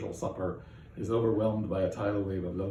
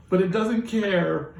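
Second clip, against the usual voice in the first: high, loud, slow, laughing sob; hammed-up laughing sob; sobbing; 9 semitones higher, 7 dB louder, slower than usual. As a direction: wail it through fake sobs. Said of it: "Now she's really sobbing".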